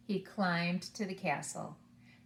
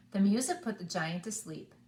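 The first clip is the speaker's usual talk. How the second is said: plain reading voice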